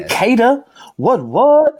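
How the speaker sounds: high, loud shout-out; excited put-on call; hammed-up hype voice. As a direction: hoot it like a hype man. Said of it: "I don't even know why I'm talking this way".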